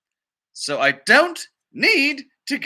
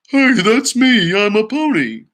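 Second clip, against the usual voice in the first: louder, bouncy, slower pony chirp; pony butting into the song history; excited pony; 5 semitones higher, 5 dB louder, slower than usual. Acted. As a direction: pop in proudly like a pony. Pony interrupts the song story.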